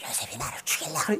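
hushed, strained imitation of a screaming woman; mock scream. Master's wife falsely accusing Joseph, mock hysterical scream. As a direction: fake a hysterical scream with the volume held back. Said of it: "그 여자가 어떻게 고함 지르겠어요".

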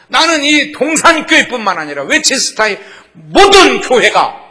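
크고 높게 외치는 설교 어조; 회중을 향해 힘껏 선포하듯. Acted. Preaching at full force. (설교자가 교회의 축제를 힘차게 선포)

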